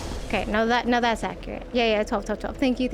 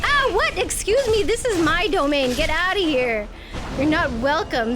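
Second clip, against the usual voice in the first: haughty mock scolding, a bit higher and louder; mock indignant; 5 semitones higher, 4 dB louder than usual. Streamer scolding a game monster, mock indignant. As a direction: shoo the intruder off your turf.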